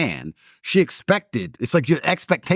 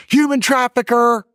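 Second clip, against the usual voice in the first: high, slow, loud jeering call of "Human trafficker"; mocking accusation; 11 semitones higher, 5 dB louder than usual. American girl filming him at the World Cup, jeering loudly. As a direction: sneer it out like a catcall. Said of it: "she filmed me on TikTok and said".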